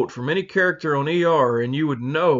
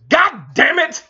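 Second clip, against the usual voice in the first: high, loud mock outburst; blooper outburst; 9 semitones higher, 4 dB louder than usual. Retelling a Potter blooper, higher, louder mock cursing. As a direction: bark it like a cursing actor who forgot his line. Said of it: "and he goes".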